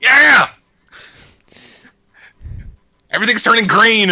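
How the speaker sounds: loud, high excited yell; excited shout. Real, not acted. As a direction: cheer at the screen. Excited at flashing credits.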